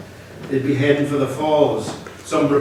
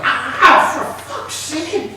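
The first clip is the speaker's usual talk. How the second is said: pained yelp; 6 dB louder, much slower than usual. Acted. Struck on head by gun.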